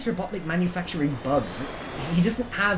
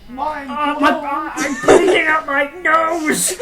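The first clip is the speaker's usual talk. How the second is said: hammed-up panic; 11 semitones higher, 7 dB louder, much slower than usual. Mock dying from paradox.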